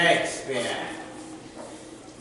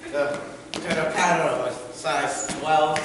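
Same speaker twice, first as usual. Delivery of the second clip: ordinary talk, nothing marked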